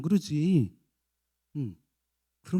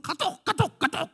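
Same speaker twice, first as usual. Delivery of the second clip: high, repeated notification chirp; messenger alert imitation. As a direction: mimic the phone's KakaoTalk alert. 카톡 알림음 흉내. (높은 카톡 알림음 흉내)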